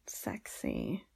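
low seductive whisper. Acted. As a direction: breathe the word low.

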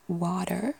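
"water" with a sounded final r, rising; variant pronunciation. Shown as a demonstration. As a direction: try an r-ful "water". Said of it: "different ways I can imagine water".

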